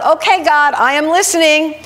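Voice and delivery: half-joking call to God; said out loud. Retold or imitated. Man in his car calling out to God half jokingly. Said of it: "half jokingly, he said out loud".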